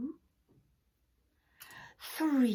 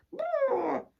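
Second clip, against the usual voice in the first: crying hen; 12 semitones higher, 8 dB louder than usual. Acted.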